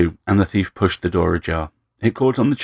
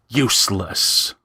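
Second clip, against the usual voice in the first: Useless! spat slowly with contempt; slow, scornful Useless! aimed to hurt; slower than usual. Acted. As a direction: spit the word Useless! at him, drawn out. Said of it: "So he spat the word again".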